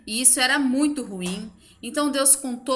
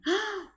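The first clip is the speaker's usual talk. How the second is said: gasp of wonder; 8 semitones higher, 6 dB quieter than usual. Acted. Storyteller builds suspense.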